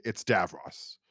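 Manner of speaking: ordinary talk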